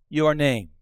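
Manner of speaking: normal talking voice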